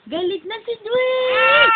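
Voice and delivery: Galit na si Dwayne pitched high and loud. Real, not acted. Mother teasing her angry baby Dwayne, high and loud.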